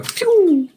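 high, loud imitated arrow whistle; arrow whoosh noise. Player shoots a cheeky last arrow, imitated whoosh. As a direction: mimic an arrow zipping off, pitched high.

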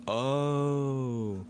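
reenacted moment of understanding; dawning realization. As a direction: stretch it out as it finally clicks. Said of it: "and I was like".

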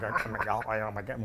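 low, soft mumbled imitation of a daughter; gurgling teen talk. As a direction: run the words together like a gurgling stomach. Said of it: "it's like a gurgling stomach".

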